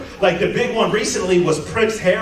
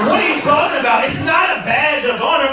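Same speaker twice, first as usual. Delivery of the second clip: higher, laughing shout back; fast incredulous higher shout; mock rant; 6 semitones higher than usual. Comedian yelling back at the heckler, laughing.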